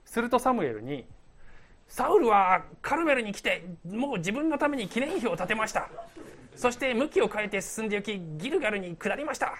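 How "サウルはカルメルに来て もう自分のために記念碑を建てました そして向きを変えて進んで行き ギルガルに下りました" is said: high-pitched herald voice; acted messenger, pitched well up; messenger's report. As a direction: announce the news in a playfully hammed-up report. Voicing the messenger's report to Samuel.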